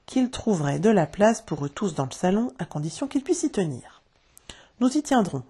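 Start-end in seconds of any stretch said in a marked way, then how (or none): 4.8-5.4 higher-pitched eager assurance; assuring. A narrator's reading. The boys assure Monsieur Darling they will fit, higher and eager. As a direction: promise it readily, pitch up. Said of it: "assurèrent-ils".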